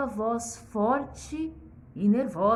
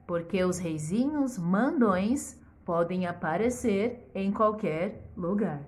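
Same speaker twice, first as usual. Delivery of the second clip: plain reading voice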